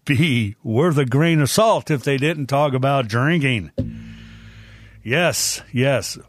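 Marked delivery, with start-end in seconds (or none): none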